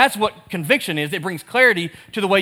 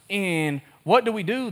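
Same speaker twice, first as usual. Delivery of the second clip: ordinary delivery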